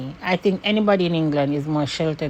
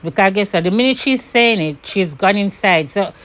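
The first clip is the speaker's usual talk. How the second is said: usual speaking voice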